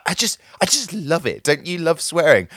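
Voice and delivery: mock-enthusiastic, high imitation; imitated radio presenter. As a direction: imitate her delight, pitched well up. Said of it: "And she's like".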